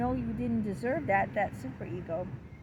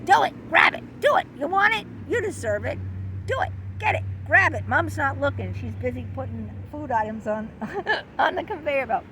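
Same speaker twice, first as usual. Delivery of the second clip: tempting id voice; 3 semitones higher, 3 dB louder, faster than usual. Acted. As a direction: push the kid to grab it, urgent and devilish. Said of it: "the id would say".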